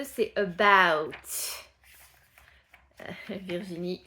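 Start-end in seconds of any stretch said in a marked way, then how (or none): 0.0-1.1 "about" slow and loud, abowt. Shown as a demonstration. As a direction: stretch "about" slowly. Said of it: "À propos de".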